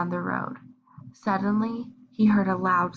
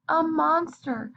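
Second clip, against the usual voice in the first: ordinary delivery, nothing marked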